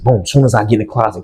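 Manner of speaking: usual speaking voice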